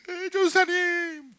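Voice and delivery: high, drawn-out call; excited mother. Retold or imitated. Mother phones the pastor with news, high and drawn out. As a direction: stretch it out, bursting with news.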